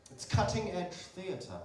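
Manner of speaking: slow, drawn-out theatrical sales pitch; high, loud, stretched promoter voice; flyer man. Retold or imitated. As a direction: proclaim it grandly to a passer-by. Flyer man pitching his play.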